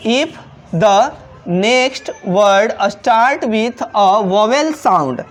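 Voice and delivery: each word drawn out slowly for the class; slow classroom dictation of an English rule. Shown as a demonstration. Teacher rereads rule slowly.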